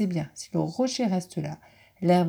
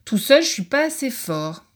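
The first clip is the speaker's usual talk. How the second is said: grumbling badger; 6 semitones higher, 6 dB louder than usual. Badger voiced in story.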